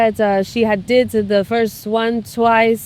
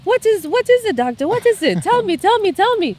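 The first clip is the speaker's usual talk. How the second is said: high, urgent begging, tell me tell me; a patient desperate to hear the result; high-pitched anxious patient pleading with the doctor; frantic pleading; 12 semitones higher than usual. Retold or imitated. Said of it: "She goes like".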